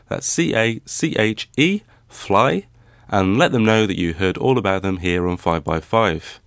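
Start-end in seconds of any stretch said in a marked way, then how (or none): 0.1-2.7 letter by letter C A C H E, then Fly rising; spelled out. Shown as a demonstration. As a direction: spell C A C H E slowly, one letter at a time. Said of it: "Check them out at cashfly dot com".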